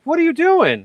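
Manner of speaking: high, loud mock bewilderment; confused friend. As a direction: act the baffled buddy. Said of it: "And they're like".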